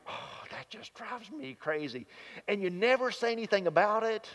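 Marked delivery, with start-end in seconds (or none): 0.1-2.1 softly grumbling to yourself; a quiet annoyed aside under the breath; mock muttered annoyance. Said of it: "it's like".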